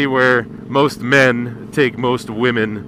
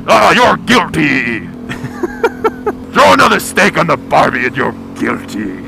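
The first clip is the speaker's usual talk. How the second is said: lightly voiced judge, louder, banging the gavel; barbecue judge; 3 semitones higher, 4 dB louder than usual. A barbecue judge sentencing someone, mock courtroom verdict. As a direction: pronounce guilty like a TV judge. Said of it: "sits behind a desk with a gavel and goes".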